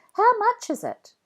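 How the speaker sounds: put-on high, bright kid voice, calling out; lively child greeting. A narrator's reading. Jack calls out to his friend Rebecca, high and loud. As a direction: call hello like an excited kid.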